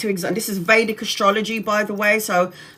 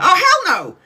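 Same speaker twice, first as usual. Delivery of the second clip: high, loud "hell no" in mock horror; horrified; 10 semitones higher, 6 dB louder than usual. Retelling watching The Nun, a loud horrified yelp. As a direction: recoil at the scary film. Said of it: "I was like".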